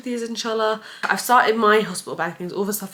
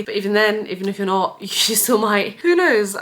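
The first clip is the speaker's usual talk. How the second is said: ordinary talk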